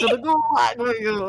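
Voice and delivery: mock Eastern European accent, half-shouted; light Eastern European accent, mock threat; hammed-up Russian gulag threat. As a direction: growl the gulag line like a cartoon Russian jailer. Playing Russian interrogator in game.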